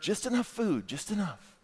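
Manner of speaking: a hint of a tidy English mother rationing food; mock-dainty "just enough" trailing away; imitating reserved wife. Wife's reserved family voiced.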